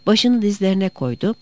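plain reading voice

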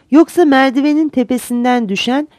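usual speaking voice

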